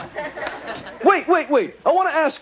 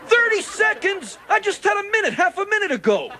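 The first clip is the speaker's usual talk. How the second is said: higher, outraged protest at being shortchanged; indignant disbelief; 7 semitones higher than usual. Acted. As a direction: argue with the timekeeper in disbelief. Protests the countdown.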